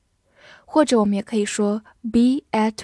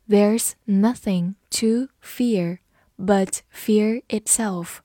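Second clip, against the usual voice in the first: slow, deliberate teaching read with words spaced apart; slow read-through; slower than usual. Shown as a demonstration. Teacher models the sentence for students, slow read-through. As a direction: read it slowly for learners to follow. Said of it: "我们慢读一遍".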